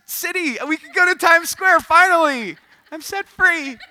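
high, loud overjoyed outburst; hammed-up joyful relief, nearly squealing; mock elated breakthrough. Speaker suddenly healed of crowd fear, mock elation.

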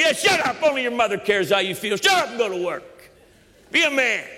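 high, forceful dismissive scolding; hammed-up dad with no sympathy; stern father. Father answers whining child, high forceful mock scolding. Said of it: "that's what he would tell me".

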